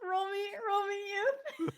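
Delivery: high crying wail; high wailing mock plea. GM mock crying roll request.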